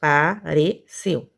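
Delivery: "Apareceu" slowly split, a-pa-re-ceu; syllable by syllable. Shown as a demonstration. Teacher testing for three syllables, "Apareceu" slowly broken up. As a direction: separate every syllable of "Apareceu". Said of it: "também não".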